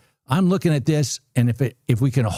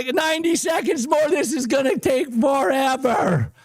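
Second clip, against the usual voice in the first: much higher yelps and shouts, real joy; thrilled high shouting; 12 semitones higher than usual. Real, not acted. Trader cheering his winning trade to viewers, shouting. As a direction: lose it as the number climbs. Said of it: "I'm so excited".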